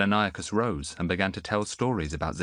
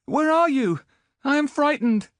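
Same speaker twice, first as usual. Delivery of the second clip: put-on frightened boy; 8 dB louder than usual. Boy lost in the mist, high frightened call. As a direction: plead like a lost child.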